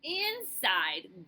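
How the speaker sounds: plain reading voice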